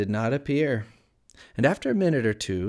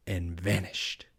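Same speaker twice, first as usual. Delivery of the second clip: ordinary delivery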